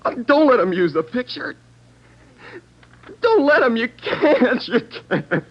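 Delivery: high, loud begging that cracks; desperate pleading. Acted. Suspect begs detective not to use his picture, breaking.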